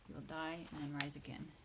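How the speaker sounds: ordinary talk, nothing marked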